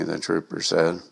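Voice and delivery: ordinary delivery, nothing marked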